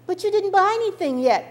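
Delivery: put-on high, loud little girl's protest; a confused kid calling after her mother; puzzled child. Little girl protests to her mother, high and loud.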